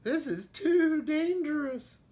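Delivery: high nervous sheep voice; scared sheep. Acted. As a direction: worry like a timid sheep. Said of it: "what she thought was".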